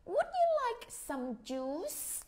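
slow rising polite offer; father offering. Retold or imitated. Father offering children juice, lightly voiced. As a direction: ask like a kind dad at the table. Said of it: "Bố của Nam mới hỏi".

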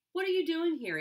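sharp, high and loud demand; stern boy. A narrator's reading. Boy sternly questions girl who intruded, stern. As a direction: challenge her like he owns the place. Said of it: "he asked sternly".

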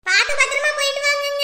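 high, loud send-off call. Acted. Wife seeing husband off, calling out. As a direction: call after someone who is leaving.

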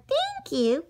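put-on high, small girl's voice; little girl voice.

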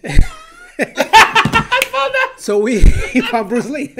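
high, loud laughing through words; laughing hard. Real, not acted. Host finds a funny picture, laughing hard. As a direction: lose it laughing while talking. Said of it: "Hold on, let me see this one".

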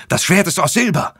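high, loud desperate screech on Silber. A narrator's reading. Paul screeching his silver sword warning at the demon. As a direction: shriek the word Silber as a last threat. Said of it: "kreischte er".

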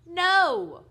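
high, very loud drawn-out roar; father's roar. A narrator's reading. Father cuts off his son at breakfast, roaring. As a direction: roar the one word at full voice. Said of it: "roared his father".

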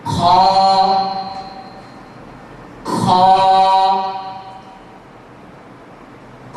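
খ said heavy and full from the upper throat, mouth open; heavy letter demo. Shown as a demonstration. Teacher demonstrates the heavy letter খ for students. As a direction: give খ a thick full sound from the top of the throat. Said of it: "আবার উচ্চারণ করছি".

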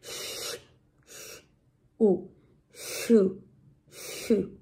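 soft "sh", then "U" as "oo", then blended "shu"; sound-by-sound demo. Shown as a demonstration. Teacher joining the letters into one sound, sound-by-sound demo. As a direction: build "shu" slowly from "sh" and "oo", with pauses. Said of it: "se unen en un solo sonido".

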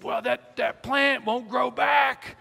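high, drawn-out mock shout; imitated yelling. Angry hiker yells back at a father about plants, imitated. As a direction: build into a stretched holler, mimicking the man. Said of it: "he's still yelling".

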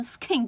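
skin with a puffed k, "s-khin"; skin, airy k; aspirated mistake. Shown as a demonstration. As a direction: pronounce skin wrongly, air bursting after k. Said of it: "如果我念成skin，".

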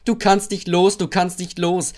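high, chanting tease; laughing taunt. A narrator's reading. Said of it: "lachten die Wagen".

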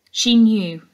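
plain reading voice

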